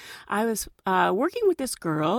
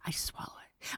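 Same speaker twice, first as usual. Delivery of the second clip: a very soft, barely voiced whisper; imitated whisper; 18 dB quieter than usual. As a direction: confess it in a breath. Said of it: "She goes".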